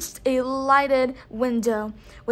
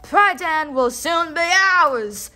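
raised, higher, louder warrior's cry; shouted boast; 4 semitones higher, 6 dB louder than usual. A narrator's reading. Warrior to his fellows above the din, shouting. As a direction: shout it over the rowdy crowd. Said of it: "shouted one voice above the others".

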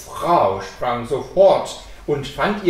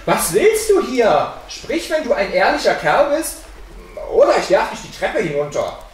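loud, high threat; calling out; 6 semitones higher, 5 dB louder than usual. A narrator's reading. Boy threatens the figure on the stairs, calling out loudly. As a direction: challenge the intruder forcefully, pitched up. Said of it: "Der Junge rief zum zweiten Mal".

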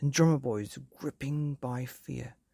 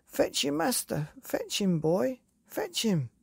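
urgent calling; 11 semitones higher than usual. A narrator's reading. Billy calls up to the dog to fetch help. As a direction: shout for help up the quarry side.

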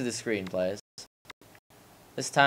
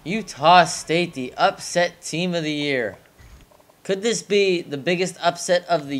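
announcer; 5 semitones higher than usual. Real, not acted. Hypes his team.

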